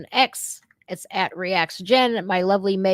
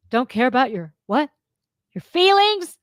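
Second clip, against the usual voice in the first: high, louder mock question; 4 semitones higher, 4 dB louder than usual. Real, not acted. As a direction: sneer it up high and loud. Mocking rhetorical question.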